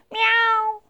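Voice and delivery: high, loud meow. Acted. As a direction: meow loudly. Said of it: "ktoś powiedział głośno".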